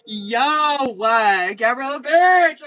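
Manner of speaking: loud, high excited yell; excited shout. Real, not acted. Greeting a viewer in chat, excited loud shout. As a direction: call out the name in delight.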